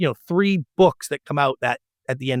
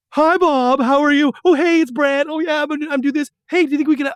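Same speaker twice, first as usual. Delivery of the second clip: schmoozy phone call; 11 semitones higher than usual. Retold or imitated. Brad calling network exec Bob, a chipper fake phone voice. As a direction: put on a chirpy schmoozing phone voice. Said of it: "pick up the phone and be like".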